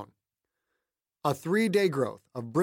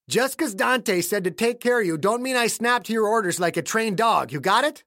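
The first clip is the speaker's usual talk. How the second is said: put-on defiant old man; 6 semitones higher, 4 dB louder than usual. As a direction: lay down the law, voice pitched up. Voices defiant caretaker Clayton.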